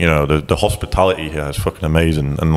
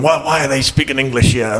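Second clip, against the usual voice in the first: higher-pitched, grumbling imitation of outrage; mock angry old man; 6 semitones higher than usual. Old veteran behind them in a Bavarian bar, mock angry. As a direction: lightly imitate an indignant veteran. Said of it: "he was mad that we were speaking English".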